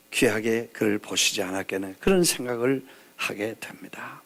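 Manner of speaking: soft breathy whisper; whispered reflection. Real, not acted. Preacher closing thought, whispered. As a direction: hush the reflection. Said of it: "preacher drops to whisper".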